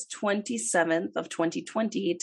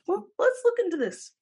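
high-pitched put-on voice. Retold own reaction, high put-on.

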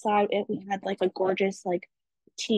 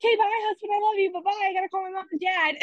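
higher, fast giddy goodbye; rushing off FaceTime with her husband, much higher and giddy; excited rush; 8 semitones higher, much faster than usual. Retold or imitated. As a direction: hurry off the call, bubbling over. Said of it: "And then I was like".

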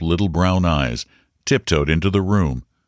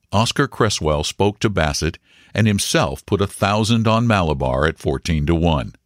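ordinary delivery

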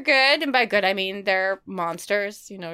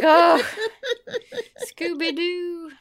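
slow, higher, mock-whining oh Scooby-Doo; exasperated owner; 7 semitones higher, much slower than usual. Host scolding her hiding cat, slow mock whine. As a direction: sigh it out like a tired pet owner. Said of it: "And it's like".

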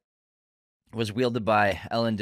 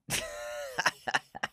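very high, squealing laugh; helpless high-pitched laughter; 8 dB quieter than usual. Real, not acted. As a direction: crack up in high peals. Laughs at the accent hint.

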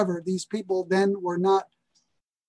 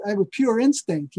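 usual speaking voice

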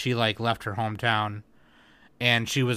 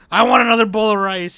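put-on grumpy old man voice; 9 semitones higher, 8 dB louder than usual. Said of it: "he kept getting mad".

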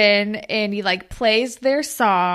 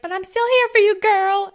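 cheery best friend; 9 semitones higher, 5 dB louder than usual. Retold or imitated. [name] quoted cheering friend.

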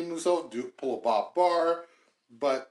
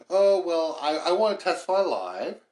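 louder, higher mock demand; mocking imitation; 4 semitones higher, 6 dB louder than usual. Host imitating Bannon setting conditions to testify, mockingly. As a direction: put on a mock insistent witness, pitched up.

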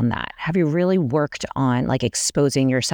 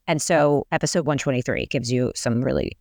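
ordinary delivery

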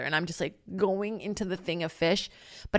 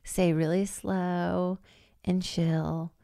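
slow; 4 dB quieter, much slower than usual. Shown as a demonstration. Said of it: "So you have to, like".

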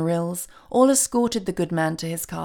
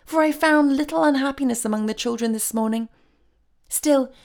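raised, pleased fairy voice; 7 semitones higher, 3 dB louder than usual. A narrator's reading. Fairy telling Santa about the children's morning, pleased and high. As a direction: report good news in a high, happy voice. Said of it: "cried the fairy in a pleased voice".

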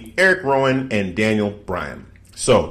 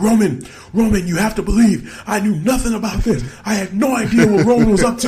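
much higher, desperate and half laughing; mock pleading Daniel Bryan; 11 semitones higher than usual. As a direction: plead innocence over the top. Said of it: "he's like".

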